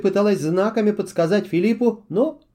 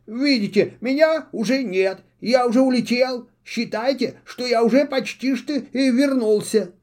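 high, hurried owl voice, anxious; eager owl; 6 semitones higher than usual. A narrator's reading. Owl assures the witch he is already gone. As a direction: scramble to please.

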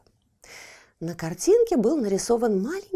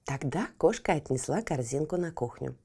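plain reading voice

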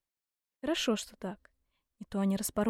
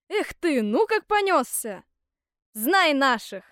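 loud, higher-pitched whoop; удалой крик; 4 semitones higher, 6 dB louder, slower than usual. A narrator's reading. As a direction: cry out boldly while urging on a mount. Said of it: "закричал он". (удалой громкий выкрик)

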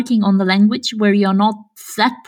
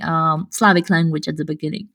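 ordinary talk

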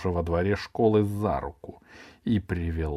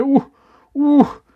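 loud, very high hooting yelps; feigned spasm outbursts; harder jolted cries; 11 dB louder than usual. A narrator's reading. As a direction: whoop sharply with each twitch. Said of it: "И снова дёрнулся ещё сильнее".

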